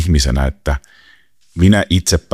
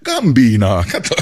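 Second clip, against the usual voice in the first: laughing through words; 6 semitones higher, slower than usual. Real, not acted. Laughing over Alko joke.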